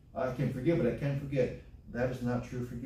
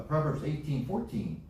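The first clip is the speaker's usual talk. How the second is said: usual speaking voice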